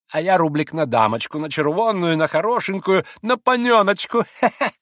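tittering voice climbing high; giggling old gambler. A narrator's reading. Maximov betting on his little queen, giggling. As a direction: coo the bet, ending in a giggle. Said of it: "прохихикал Максимов".

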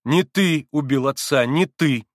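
firm repeat, stress on не. A narrator's reading. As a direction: state it firmly, each word set down. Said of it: "твёрдо повторил Алёша".

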